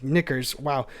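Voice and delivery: ordinary talk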